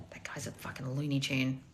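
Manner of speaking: soft whispered put-down; whispered jab. Real, not acted. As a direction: lean in and whisper the insult. Muttered aside to chat.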